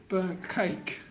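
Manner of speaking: ordinary talk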